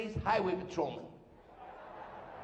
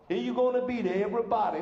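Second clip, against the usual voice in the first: hammed-up New Orleans accent; half Southern, half Brooklynese; 4 semitones higher, 4 dB louder than usual. Shown as a demonstration. New Orleans local talking, in a Southern-Brooklynese accent. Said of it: "They talk like that".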